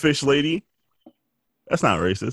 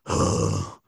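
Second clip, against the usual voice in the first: low, weary groan; mock tired groan; 13 semitones lower, 6 dB quieter than usual. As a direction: drag it out like you can't get up. Groaning getting out of bed.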